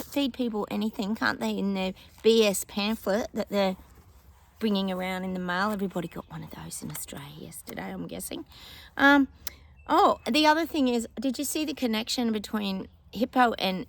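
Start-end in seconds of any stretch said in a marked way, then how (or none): 5.7-8.4 quick hushed whisper. Real, not acted. Conspiratorial aside to viewers.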